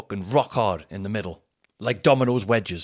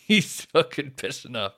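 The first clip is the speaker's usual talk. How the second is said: usual speaking voice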